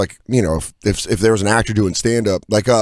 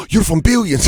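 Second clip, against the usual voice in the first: astonished Londoner, pitched up; London accent; 9 semitones higher than usual. Retold or imitated. London stranger recognising TV actor, astonished London accent. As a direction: imitate a London fan as it clicks. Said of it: "I was in London".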